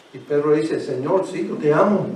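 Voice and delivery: low, soft retelling of Peter and the Lord; hushed storytelling. Acted. Preacher retelling Jesus and Peter to the congregation, hushed. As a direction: draw them in with a hushed voice.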